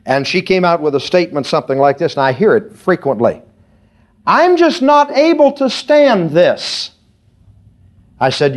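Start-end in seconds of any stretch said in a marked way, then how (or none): 4.3-6.9 whiny complaint. Retold or imitated. Caller complaining on phone.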